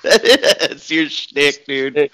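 slow, laughing through it. Real, not acted. Teasing his friend, laughing slowly.

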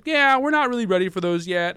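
high, loud mocking employer voice; high loud mock of the employer brushing off. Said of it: "they're like".

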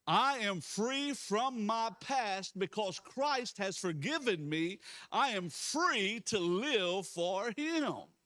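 loud, high, slow preacher's declaration; bold proclamation. Real, not acted. Pastor leading church to proclaim God's word, bold proclamation. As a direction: proclaim it over the whole room, stretching each phrase.

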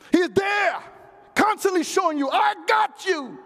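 high shouted assurance, last words stretched; impassioned preaching. Real, not acted. Preacher assuring congregation God has them, high impassioned shout. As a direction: proclaim it at full force, pitched high.

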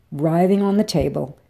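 ordinary delivery, nothing marked

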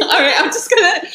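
laughing hard, high and loud; can't stop laughing. Real, not acted. Laughing fit after burp joke.

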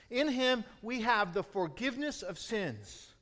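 rising, loud, ringing proclamation of freedom; preaching at full force. Real, not acted. Preacher to congregation, proclaiming release from chains, loud and high.

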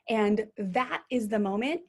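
usual speaking voice

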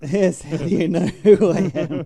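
mock the operator while laughing. Mocking caller, laughing.

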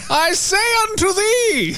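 high, slow, shouted proclamation; town crier. Pretend. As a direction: proclaim it to the crowd. Mock herald proclamation.

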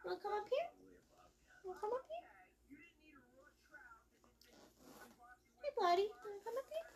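high sing-song coaxing. Real, not acted. Coaxing the cat up.

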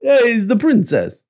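a high, brash New York Italian "Hey"; New York Italian accent. Retold or imitated. Joking player in a New York Italian accent. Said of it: "That's like a New York Italian".

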